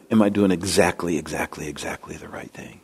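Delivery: fading from low and soft into a whisper; imitating anxious muttering to oneself; fretting self-talk. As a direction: let the worried question trail down to a whisper. Acts out his old worrying.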